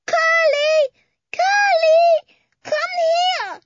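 high, loud wailing call; little boy calling. Acted. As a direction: shout for the pig like a toddler. Toddler calling for pig.

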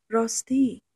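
ordinary delivery

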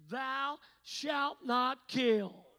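slow, weighty scripture reading; slow solemn quote. Shown as a demonstration. Preacher quotes the commandment slowly and solemnly. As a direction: lay out each word slowly. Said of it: "right there in the commandments".

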